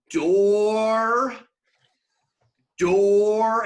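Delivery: imitating someone stretching "door" into one long, loud word; "door" held long, as a mock accent demo; elongated word. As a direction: draw out the vowel of "door" mockingly. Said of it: "he just elongated a four-letter word".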